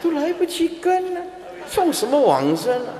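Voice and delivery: high, laughing mock complaint; complaining dying man. Monk playing the dying man scolding chanters. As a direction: whine as the dying man who can't keep up. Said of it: "他就会起来".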